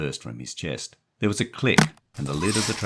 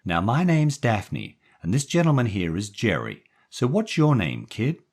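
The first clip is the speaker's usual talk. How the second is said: gangster's moll; 6 semitones higher, 4 dB louder than usual. A narrator's reading. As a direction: coax the kid gently as the moll. Daphne speaks to the boy.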